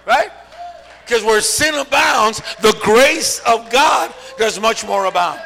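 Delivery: loud, emphatic preacher shout; full-force preaching. Real, not acted. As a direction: drive it home to the congregation, stretching the key words.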